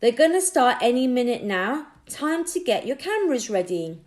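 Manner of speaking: man in tracksuit calling loudly to everyone; shouted. Acted. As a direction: call out over a crowd.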